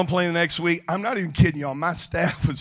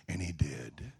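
preacher's soft closing; 7 semitones lower, 12 dB quieter than usual. Real, not acted. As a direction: ease the congregation into reflection, softly.

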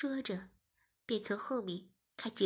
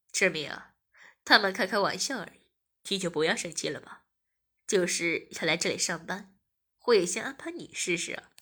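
lower, louder man's voice; male protagonist, teasing; 5 semitones lower, 6 dB louder than usual. A narrator's reading. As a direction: soothe and tease in a low male voice.

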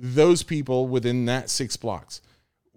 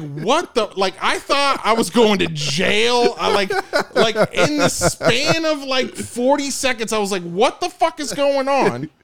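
high, loud, laughing disbelief; incredulous outburst; 10 semitones higher, 7 dB louder than usual. Retold or imitated. Telling his co-host he thought he was going to jail, loud incredulous outburst. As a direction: retell your shock at full pitch, half laughing.

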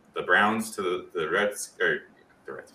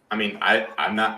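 ordinary talk, nothing marked

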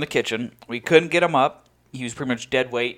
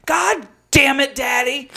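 loud, slow, fed-up cry of the name; exasperated yell; 11 semitones higher, 4 dB louder than usual. Retold or imitated. Friend yelling after drunk Daddy, exasperated shout. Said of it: "Beardy Locks is just like".

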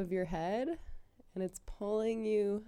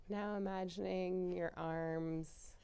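ordinary delivery

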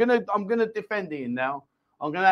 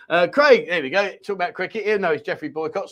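usual speaking voice

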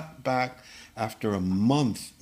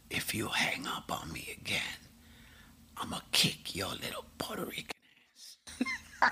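hoarse whispered rasp, softer; 12 dB quieter than usual. Retold or imitated. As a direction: threaten in a raspy whisper. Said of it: "and he says".

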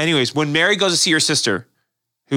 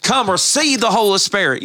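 put-on high-pitched revival preacher accent; hammed-up church-revival accent, pitched up; put-on Pentecostal preacher accent; 8 semitones higher than usual. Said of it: "I don't know why I made that accent".

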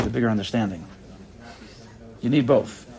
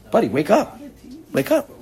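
second wake up jumps much higher; mock scolding wake-up call; 9 semitones higher than usual. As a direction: shake him out of it, half-teasing rebuke. Rebuking an angry man.

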